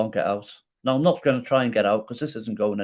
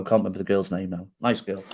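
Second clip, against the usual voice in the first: usual speaking voice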